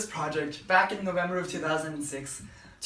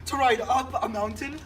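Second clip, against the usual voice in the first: cheesy ad read; 6 semitones higher than usual. Acted. Pitching a blue bike to viewers, hammed-up commercial voice. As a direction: sell the blue bike like an infomercial host.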